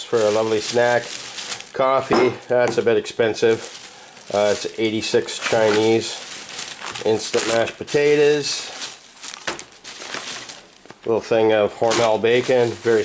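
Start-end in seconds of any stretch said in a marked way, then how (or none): none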